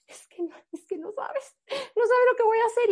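imitating the sister crying, pitched high; teary half-laugh, half-sob, voice up high; tearful sister. Sister bursting home to tell Liz her news, tearful and high.

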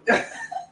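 sudden high squeal of laughter. Real, not acted. Host laughs at fat joke.

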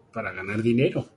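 ordinary talk

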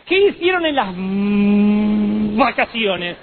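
high, loud teacher with a drawn-out hum; old-time schoolteacher. Retold or imitated. Teacher quizzing pupils about their holidays, mimicked schoolmarm. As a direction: mimic a strict schoolmistress, dragging the middle. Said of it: "En mi tiempo la señorita decía".

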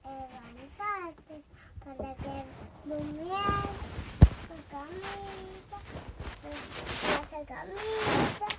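barely audible murmur; very quiet. Real, not acted. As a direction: say it almost under your breath. Child speaks very softly. (muy bajito)

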